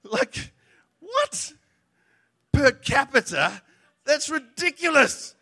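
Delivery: the preacher mocking his own disbelief at the medal claim; incredulous. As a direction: react like you can't believe your ears. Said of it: "I'm listening to this going".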